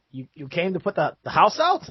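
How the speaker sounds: stammering, rising to a high question; baffled guard trying to work it out; incredulous, pitch climbing at the end; confused guard. Acted. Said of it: "they look confused".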